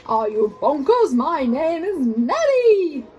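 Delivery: loud, slow outraged monster voice; howling monster. Acted. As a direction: bellow it, offended. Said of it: "with a horrible howl".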